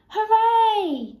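high, loud, long hooray; cheer. A narrator's reading. Animals greet rabbit bringing carrots, loud cheer. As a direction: cheer at full voice. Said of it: "cheered the animals".

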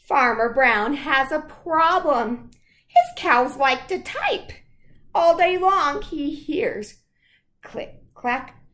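loud, lively storybook read; picture book read to children. Acted. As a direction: read aloud to the class with gusto. Reads picture book aloud.

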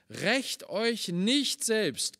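Rächt euch nicht selbst drawn out slowly; solemn slow preaching; slow solemn warning. Acted. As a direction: let each word land, slowly.

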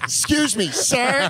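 mock polite valet. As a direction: play a stuck-up valet brushing someone off. Mocking the valet's reply.

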